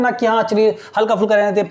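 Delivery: ordinary talk, nothing marked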